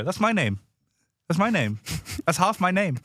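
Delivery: each line falls on the name; rhythmic repeated chant; steady cadence. Shown as a demonstration. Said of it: "do the same cadence with your name".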